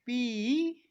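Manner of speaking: पी with a long held vowel; stretched P. Shown as a demonstration. Teacher modelling P for students, long पी. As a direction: drag out the ई in पी. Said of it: "इसी तरह से".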